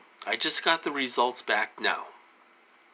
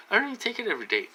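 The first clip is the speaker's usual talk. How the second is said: higher and fast, fed-up insistence; exasperated repeat; 10 semitones higher, much faster than usual. Real, not acted. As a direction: rattle it off again, fed up. Same complaint said again, exasperated.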